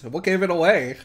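loud, mocking, laughing through it; sarcastic laugh. Real, not acted. Streamer mocking the girlfriend's obvious discovery, laughing sarcastically. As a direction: scoff at how obvious it was.

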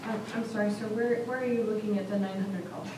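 ordinary talk, nothing marked

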